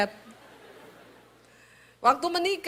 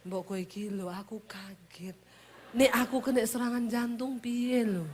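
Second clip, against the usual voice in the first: mild startled grumble, very soft; soft startled husband; 6 dB quieter than usual. Retold or imitated. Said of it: "husband startled by her call".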